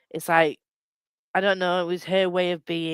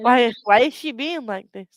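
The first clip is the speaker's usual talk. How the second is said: high plaintive complaint, louder; whiny mother voice; 6 semitones higher, 6 dB louder than usual. Retold or imitated. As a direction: wail it like a hurt parent. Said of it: "She was like".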